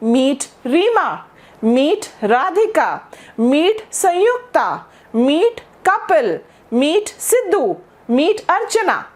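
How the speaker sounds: slow, clear introductions; teacher modelling slow introductions; slow demo introductions. Shown as a demonstration. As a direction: say each name slowly and clearly. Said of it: "friend का परिचय मैं कैसे कराऊंगी?".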